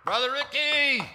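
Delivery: high screeching call; shrill anger. Retold or imitated. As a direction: shriek the name across the hall. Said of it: "it was a screeching voice".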